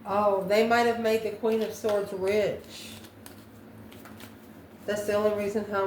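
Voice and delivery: normal talking voice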